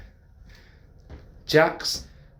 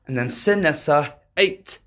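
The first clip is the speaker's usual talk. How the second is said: usual speaking voice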